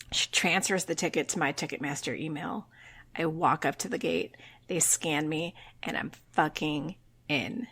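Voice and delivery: low, soft, suspenseful telling; hushed climax. Real, not acted. Fan telling how they got into the show, hushed climax.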